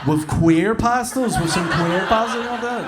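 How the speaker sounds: high, loud, laughing through the question; giggling as he echoes a heckle. Real, not acted. Comic teasing a punter, laughing high and loud. Crowd banter, mocking a request.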